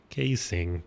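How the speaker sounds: "Casing," said low and soft, to himself; repeating quietly. Retold or imitated. Noting the mechanic's word "Casing," low and soft. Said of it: "And I was like".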